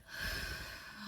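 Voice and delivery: long, soft, releasing exhale; audible cleansing breath; long sigh. Real, not acted. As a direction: breathe it all out slowly. Clearing the energy she picked up.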